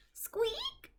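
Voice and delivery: tiny high whispered squeak; mouse squeak. Acted. Peanut the mouse peeps from the pocket, tiny whispered squeak.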